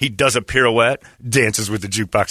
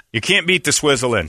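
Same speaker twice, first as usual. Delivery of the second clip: normal talking voice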